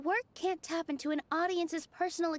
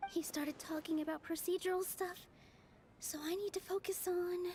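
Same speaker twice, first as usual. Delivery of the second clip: inner thoughts; 7 dB quieter than usual. Acted. Private thought mid-debate.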